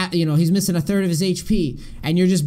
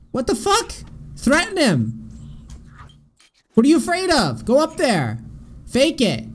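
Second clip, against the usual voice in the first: higher, yelling orders at the replay; excited yelling; 6 semitones higher than usual. Real, not acted. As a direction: urge the player on like a frustrated coach. Coach yelling at player replay.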